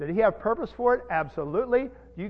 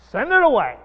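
forceful proclamation; 7 semitones higher, 11 dB louder than usual. Acted. Preacher explaining aphiemi.

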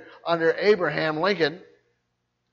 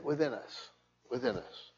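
trailing away much softer and lower; fading repeat; 5 semitones lower, 16 dB quieter than usual. Real, not acted. Preacher ending the thought on resistance within, fading. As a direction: let it die away to near silence.

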